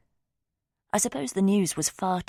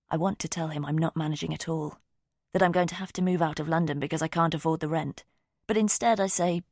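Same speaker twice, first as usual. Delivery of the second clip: plain reading voice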